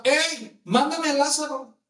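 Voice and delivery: high-pitched acted command; bossy order. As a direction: order it like a rich master. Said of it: "está acostumbrado a ... A mandar". (orden mandona, en voz alta y aguda)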